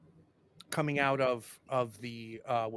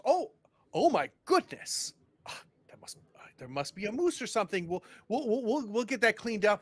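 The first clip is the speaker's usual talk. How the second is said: flustered mayor; 7 semitones higher than usual. Acted.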